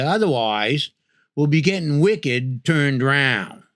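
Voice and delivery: mildly exasperated Maine local; teasing Maine accent, mild scolding. Shown as a demonstration. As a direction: scold the driver in light down-east Maine accent. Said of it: "how folks say it up here".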